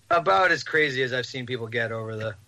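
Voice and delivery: ordinary delivery, nothing marked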